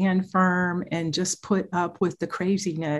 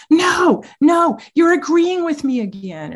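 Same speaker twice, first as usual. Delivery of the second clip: much higher, louder mock protest; imitating frustrated teen; 9 semitones higher, 6 dB louder than usual. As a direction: act out the exasperated teen who's caught on. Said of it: "And he goes".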